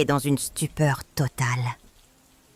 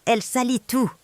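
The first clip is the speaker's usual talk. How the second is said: shouting for security; 6 semitones higher than usual. A narrator's reading. Son-in-law shouting for security to remove her, raised frantic yell. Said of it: "a hurlé Antoine".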